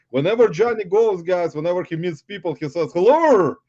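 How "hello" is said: loud, high, friendly "Hello!"; cheery greeting. Retold or imitated. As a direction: call out a big hello to strangers. Retells Johnny greeting strangers.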